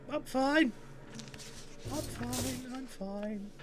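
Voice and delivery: shaken, high and shrinking; rattled self-reassurance. Real, not acted. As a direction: insist it in a high voice that dwindles away. Reassuring self after being scared.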